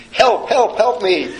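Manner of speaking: high, loud mock cry of help me; half-laughing, imitating a panicked yell; laughing through an imitated call for help; retold scream for help. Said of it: "the phlebotomist starts screaming".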